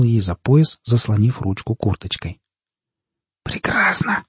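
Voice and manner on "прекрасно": sharp whispered hiss. A narrator's reading. Captive secretly approving the girl, hissing. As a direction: whisper it under the breath. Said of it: "прошипел Кайлей".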